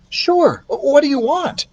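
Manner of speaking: put-on high friendly boy; boy happy to help; boy's voice. Keith voiced.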